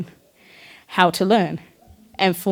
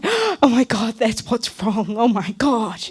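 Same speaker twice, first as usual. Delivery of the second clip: mother's shock; 3 semitones higher, slower than usual. Retold or imitated. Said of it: "She's like".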